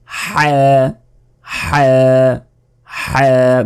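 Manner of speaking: হ্যাঁ squeezed from a tight throat; গলায় চাপ দিয়ে হ্যাঁ; pressed throat ha. Shown as a demonstration. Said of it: "গলায় চাপ দিয়ে এটা উচ্চারণ করতে হয়".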